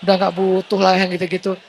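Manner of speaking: pompous mock boasting; smug self-importance. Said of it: "nggak boleh terlalu sok kegeeran".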